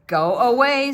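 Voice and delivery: loud gruff command; the man shoos the next dog, loudly; gruff go away. Acted. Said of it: "said the man".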